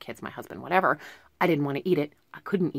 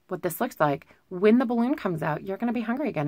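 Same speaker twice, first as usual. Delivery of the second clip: ordinary delivery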